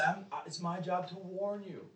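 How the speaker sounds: usual speaking voice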